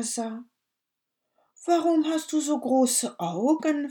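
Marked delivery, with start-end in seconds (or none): 1.7-3.9 put-on much higher, louder wondering child; amazed little girl asking. Girl voiced in story.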